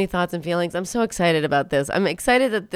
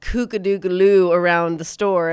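ordinary talk, nothing marked

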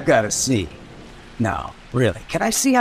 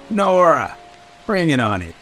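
raised, higher-pitched call; 4 semitones higher, 5 dB louder than usual. Acted. Speaker to Nora after cursing, calling out. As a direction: call her name out loudly.

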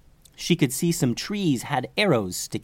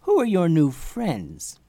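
higher, slow, curious question; friendly curiosity; 5 semitones higher, slower than usual. Acted. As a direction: ask with a lifted, drawn-out curiosity. Greeting an old friend.

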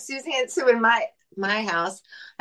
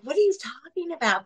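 voicing her teens, pitched higher and baffled; kids baffled; 6 semitones higher than usual. Mother voices her teens reacting at the gas pump, baffled and higher. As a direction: play the puzzled teenager, asking in disbelief. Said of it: "they're like".

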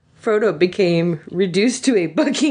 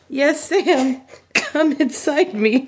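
high, slowing moan of assent; acted moaning; 7 semitones higher, slower than usual. A narrator's reading. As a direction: moan it in a raised, theatrical voice. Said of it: "Frodo moaned against Sam's shoulder".

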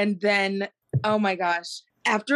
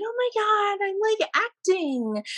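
much higher, airy mock girly actress voice; 12 semitones higher than usual. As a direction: play a ditzy girl gushing about acting, pitched way up. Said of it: "in this high girly voice".

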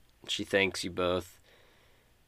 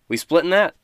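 eager companion; 9 semitones higher, 9 dB louder than usual. Acted. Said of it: "looks at you and says".

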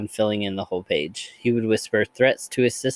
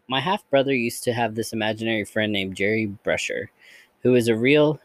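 usual speaking voice

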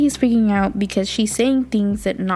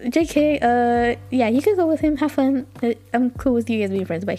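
flustered backpedal; 3 semitones higher than usual. Retold or imitated. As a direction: scramble to take it back, embarrassed. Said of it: "And then he's like".

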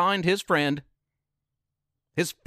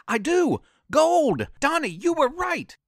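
much higher, thrilled shout building to the name; excited scream; 10 semitones higher than usual. Acted. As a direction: cry out in triumph at finding the gold. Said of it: "The last words Donnie heard Teresa scream".

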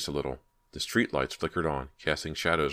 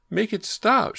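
very high, loud panicked scream; terrified shriek; 7 dB louder, slower than usual. A narrator's reading. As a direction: shriek it in pure terror. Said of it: "she shrieked".

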